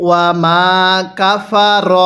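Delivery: ওয়া মা কাফার in slow separate pieces, "ro" not "ra"; measured chant, holding the "maa"; teaching recitation. Shown as a demonstration. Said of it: "যদি র জবর রা পড়ি". (ধীরে ভেঙে ভেঙে তিলাওয়াত, "রা" নয় "র")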